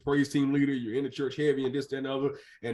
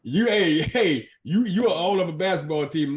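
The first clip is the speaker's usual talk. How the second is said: excited call-out; 4 semitones higher, 6 dB louder than usual. Real, not acted. Host calling out to guest excitedly.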